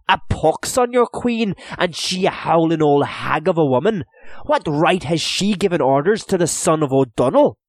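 high, loud, scornful; defiant outburst. A narrator's reading. Hugh hurling insults at the merchant about the Queen. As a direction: spit defiance at the Queen's man.

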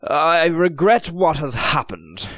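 feigned regret, polished and careful; smooth English officer. Acted. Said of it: "he said to Deluzan".

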